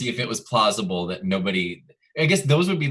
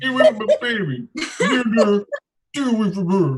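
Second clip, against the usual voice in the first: high, loud hammed-up caricature; Eric voice; 10 semitones higher, 5 dB louder than usual. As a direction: ham up a frantic protective father, pitched way up. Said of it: "That was Eric's voice".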